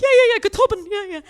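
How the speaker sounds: very high, loud, over-eager agreement; pretending to understand. Going along with barista's Irish, in high, over-keen fake agreement. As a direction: bluff along with frantic false enthusiasm. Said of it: "I pretended to understand what he was saying".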